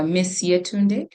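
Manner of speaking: ordinary talk, nothing marked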